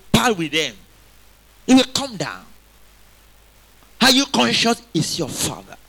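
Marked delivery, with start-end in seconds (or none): none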